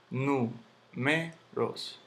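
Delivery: números very slowly as nú-me-ros; syllable by syllable. Shown as a demonstration. Teacher sounding out the word for students, very slow separate syllables. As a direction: pull números apart with pauses. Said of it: "aquí lleva su tilde".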